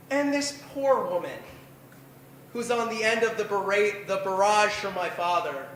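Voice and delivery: ordinary talk, nothing marked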